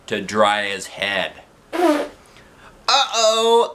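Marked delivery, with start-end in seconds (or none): 1.7-3.7 high, loud worried uh-oh; alarmed uh-oh. Acted. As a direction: spot trouble coming. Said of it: "I said".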